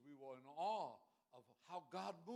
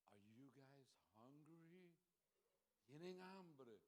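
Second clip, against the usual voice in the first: slow, soft question with a rising end; little old pastor; 8 dB quieter, slower than usual. Retold or imitated. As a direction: imitate a gentle old pastor offering food. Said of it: "That's how he talked".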